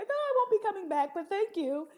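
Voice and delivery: high, loud, fast mock-polite exit; breezy fake politeness, laughing; laughing brush-off. Turning down the therapists, laughing.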